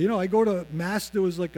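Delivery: ordinary talk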